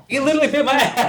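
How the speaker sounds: laughing in disbelief, high. Real, not acted. Laughing while telling security about the bite. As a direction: blurt it out through laughter.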